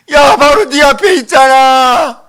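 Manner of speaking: high, loud, slow protest; hammed-up wail of complaint; exasperated cry. God tells the shouter He is right there, exasperated cry.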